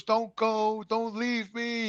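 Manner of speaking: high, drawn-out plea, imitating his father; pleading wail, held back in volume; mock anguished yell. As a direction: retell a desperate cry without raising the volume. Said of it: "He was yelling, you know".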